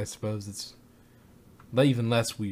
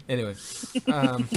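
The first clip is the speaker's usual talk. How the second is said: ordinary talk, nothing marked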